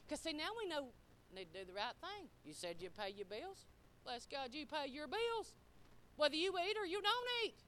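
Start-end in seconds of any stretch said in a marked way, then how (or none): none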